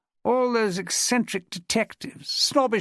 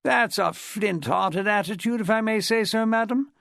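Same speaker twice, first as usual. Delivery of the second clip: put-on lightly posh English public school accent; 4 semitones higher than usual. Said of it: "in an accent redolent of one of the better public schools".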